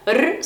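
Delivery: "R" rolled strongly; strong Spanish rolled r; trilled r. Shown as a demonstration. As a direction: trill the "R" hard. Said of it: "só que bem vibrado".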